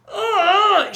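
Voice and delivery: high mock groan of frustration, shaking a fist; frustrated groan. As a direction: groan up at the sky in mock despair. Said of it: "she's shaking her fist at nature".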